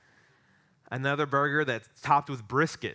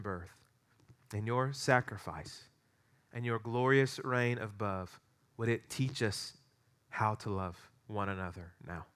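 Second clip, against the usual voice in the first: prayer; 2 semitones lower than usual. Real, not acted. Pastor leads the congregation in opening prayer, prayerful.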